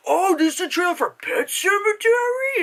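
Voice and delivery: high-pitched mocking question; squeaky mock surprise; mimicked clueless moviegoer. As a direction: imitate an oblivious theater chatterer. Mimicking clueless companion in theater.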